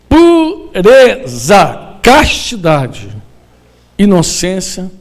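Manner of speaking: very slow, each word stretched; slow, deliberate listing; drawn-out words. Acted. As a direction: lay out the virtues slowly, weighing each. Drawn-out emphatic virtue list.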